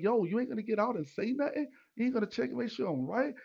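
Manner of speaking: high, quick challenge; retold indignant confrontation. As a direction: re-enact calling the driver out, fast and high.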